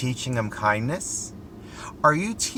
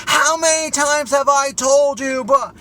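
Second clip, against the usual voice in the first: much higher, much louder scolding scream; screaming mother; 14 semitones higher, 7 dB louder than usual. Retold or imitated. An angry mother screaming at her son in the parking lot, shrieking. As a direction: yell it across a parking lot at your kid. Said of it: "screaming that everybody in the parking lot could hear her".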